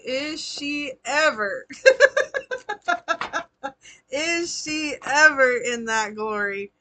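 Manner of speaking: slow words broken by laughter; laughing. Real, not acted. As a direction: laugh through the question, slowly. Laughing while teasing.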